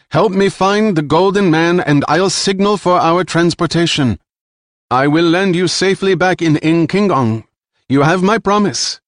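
high, loud, smooth false promise; cold promise. Acted. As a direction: sell them a lie confidently. Jilan bargains with the Fargos.

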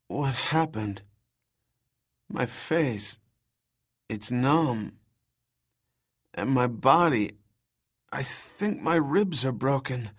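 soft, pained woman's voice; weak injured woman. Acted. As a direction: speak through pain, softly. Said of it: "Her voice was ragged and weak".

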